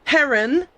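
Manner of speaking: Heron as Aaron with an H in front; added H. Retold or imitated. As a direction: breathe an H onto the name, Heron. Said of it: "in front of Aaron".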